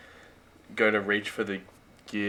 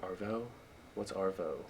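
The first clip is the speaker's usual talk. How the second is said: puzzled American imitation, much softer; American accent; 13 dB quieter than usual. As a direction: put on an American accent, quietly questioning. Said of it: "The American accent's spot on".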